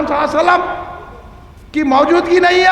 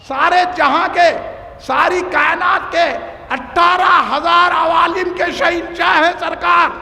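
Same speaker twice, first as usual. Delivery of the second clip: fiery preaching; 3 semitones higher than usual. Acted. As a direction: build the praise of the king of all worlds. Fervent praise, rising to verse.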